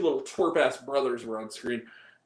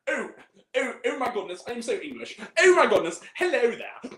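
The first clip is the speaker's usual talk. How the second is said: mock English accent, pitched up; hammed-up, higher English impression; exaggerated English accent; 5 semitones higher, 3 dB louder than usual. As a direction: overdo the Englishness on every vowel. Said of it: "like, an exaggerated English accent".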